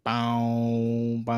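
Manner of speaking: long, drawn-out droning "bow"; sung guitar riff. Shown as a demonstration. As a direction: hum out a slow riff on "bow". Imitates the song's main riff.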